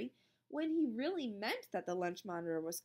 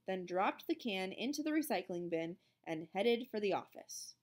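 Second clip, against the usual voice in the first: usual speaking voice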